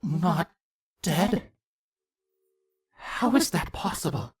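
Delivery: plain reading voice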